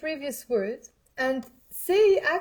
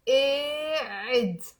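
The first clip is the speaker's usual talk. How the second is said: slow model; much slower than usual. Shown as a demonstration. Teacher slowly models قاعد for students to repeat. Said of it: "just pronounce with me".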